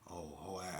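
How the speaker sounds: a quiet sneering hiss; hissed insult. Retold or imitated. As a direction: hiss it under your breath at him. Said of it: "always hissing like".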